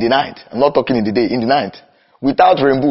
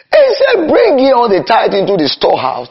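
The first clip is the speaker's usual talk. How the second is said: preaching cry; 5 semitones higher than usual. Acted. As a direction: launch the quote with a pulpit shout. Quotes Malachi, crying out.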